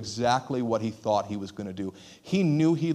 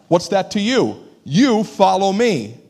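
slow, louder, firm command; stern rebuke; 5 semitones higher, 7 dB louder, slower than usual. Retold or imitated. Jesus confronting Peter, stern and slow. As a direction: come at him hard. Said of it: "Now he comes at him".

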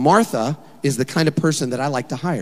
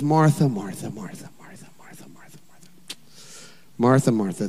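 softer, lower, fading to a whisper; soft and tender; 5 semitones lower, 5 dB quieter than usual. Acted. Jesus gently calming worried Martha, tender fading whisper. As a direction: call her name gently, soothing her. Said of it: "You can hear the softness in his voice".

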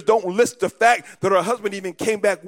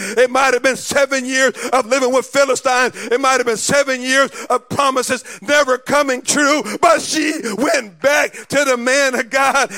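loud, rising preacher's cry; preaching at full force; 3 semitones higher, 4 dB louder than usual. Acted. Preacher rallying the congregation, loud and soaring. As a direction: build the church to a roar.

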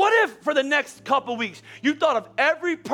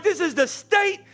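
slow, higher, fervent mock proclamation; mock sports fan; 8 semitones higher, slower than usual. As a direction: proclaim it like a hyped-up parent. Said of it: "We're like".

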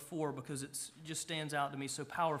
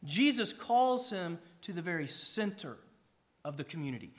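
ordinary talk, nothing marked

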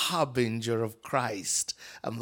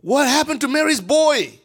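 surprised villager; 11 semitones higher, 7 dB louder than usual. Retold or imitated. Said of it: "Jesus is like".

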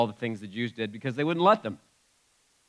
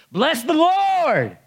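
long, high, full-voiced shout; shout of praise; 8 dB louder, much slower than usual. Shown as a demonstration. Preacher showing a congregation how to shout praise, loud commanding shout. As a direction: belt it out, stretching the last word. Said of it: "That's shouting, that's commanding, that's".

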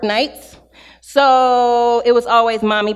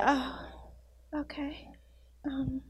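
whispered, deflated shock; stunned whisper; 19 dB quieter, slower than usual. Retold or imitated. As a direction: breathe it out, taken aback. Stunned by car repair cost.

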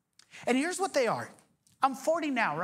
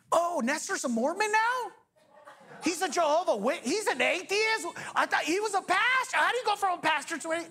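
mock gossip; 8 semitones higher than usual. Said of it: "mock gossip about him".

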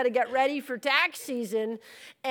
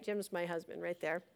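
usual speaking voice

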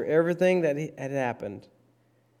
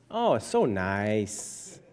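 mock gushing. Preacher mimics what everybody says of Jesus' teaching, mock gushing. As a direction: stretch it out in mock admiration. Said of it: "Everybody says that Jesus' teaching was just".

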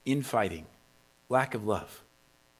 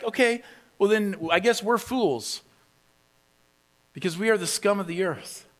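higher-pitched mock concession; sarcastic concession; 6 semitones higher than usual. Paul answering believers who expect perfection, sarcastic concession. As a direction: give in with pointed irony. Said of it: "Paul would stand here and saying".

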